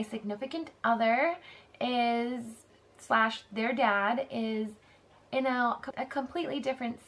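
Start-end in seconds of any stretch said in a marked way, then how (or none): none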